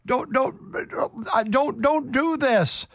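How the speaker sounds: put-on a light touch of a grieving old father; mild pleading Jacob. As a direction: beg softly like a father losing his sons. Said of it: "And the Bible said".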